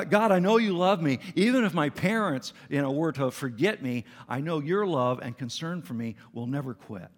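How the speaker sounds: fervent pledge of trust to God; emphatic declaration. Acted. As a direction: proclaim it with conviction, easing at the end. Paraphrases David's confident prayer.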